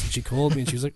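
ordinary delivery

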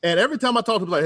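ordinary delivery

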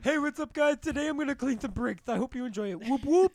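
chirpy fake YouTuber greeting fans; mock YouTuber.

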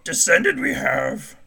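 high, slow Yoda croak; slow mock-solemn Yoda; Yoda impression. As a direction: mourn the chart drop as Yoda. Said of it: "fun to talk like Yoda".

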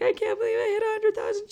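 high tearful wail of joy; happy bawling. Retold or imitated. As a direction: sob it out in disbelief. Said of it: "I'm literally bawling my eyes out".

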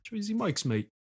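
mock British accent. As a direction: toss off "mate" like a Londoner, tongue in cheek. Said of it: "Why you say mate".

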